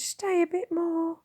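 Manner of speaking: put-on high, slow child's plea; begging boy. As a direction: draw the words out, begging. Will begs.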